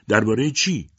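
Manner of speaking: mother snapping the question back; sharp question. A narrator's reading. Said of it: "روزا تند پرسید".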